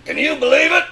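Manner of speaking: usual speaking voice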